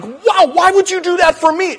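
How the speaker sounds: put-on a kid overwhelmed by kindness; amazed child. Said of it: "this thing's like".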